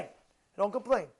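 usual speaking voice

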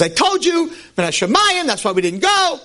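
I-told-you-so father; vindicated father. Acted.

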